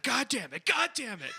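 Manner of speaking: imagining his friend cursing in the car; mock cursing. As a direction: imitate a friend swearing. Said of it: "imagining you pulling up and be like".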